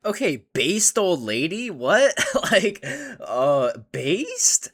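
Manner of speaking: high, loud disbelief breaking into laughter; amused shock. Real, not acted. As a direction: react like you can't believe what she said. Reacting to an old lady's remark.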